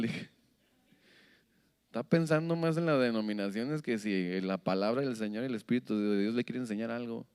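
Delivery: ordinary talk, nothing marked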